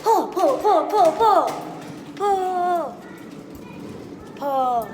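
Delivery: high, loud laughing cheers; tug of war. Real, not acted. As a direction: egg it on with yelps. Cheering on while pulling.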